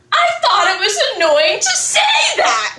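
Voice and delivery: mock them while cracking up; laughing mock shout. Mocking hypocritical friend, laughing.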